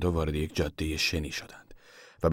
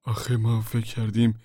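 higher, hesitant excuse; mildly pleading, pitched up; apologetic; 4 semitones higher than usual. A narrator's reading. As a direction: offer a meek explanation to the boss. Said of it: "تیموتی با لحنی پوزش طلبانه ادامه داد".

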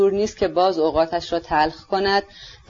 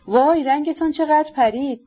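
higher, startled cry of worry; sudden alarm; 7 semitones higher than usual. A narrator's reading. Aglaya alarmed at the prince's pale face, startled cry. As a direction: gasp it out, suddenly frightened for him. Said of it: "آگلایا ناگهان وحشت کرد و پرسید".

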